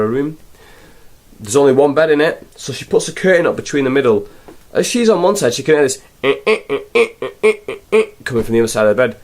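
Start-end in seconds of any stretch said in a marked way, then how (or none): 6.5-8.0 rhythmic mock effort grunts; grunting. Imitating Hogan's press-up grunts behind the curtain. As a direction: puff out little strained grunts.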